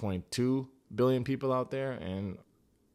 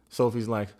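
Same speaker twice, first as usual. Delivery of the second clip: ordinary talk, nothing marked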